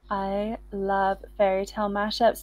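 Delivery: normal talking voice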